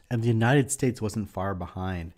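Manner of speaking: usual speaking voice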